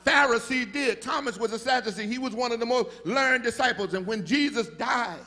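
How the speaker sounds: shouting, high, preacher's climax with Jesus's voice rising; full-force preaching. Acted. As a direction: build it to a roaring, sung-out whoop.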